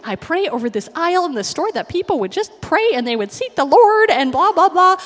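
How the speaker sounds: high, loud excited rambling; excited gush. Retold or imitated. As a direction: pour it out in a rush of enthusiasm. Walmart employee excited about prayer.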